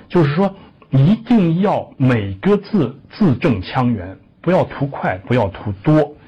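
ordinary talk, nothing marked

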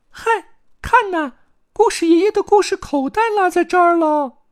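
very high, loud chick squeal; excited little chick. A narrator's reading. Chick spots Grandpa's forgotten story pocket, calling out excitedly. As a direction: pipe up at a surprise discovery.

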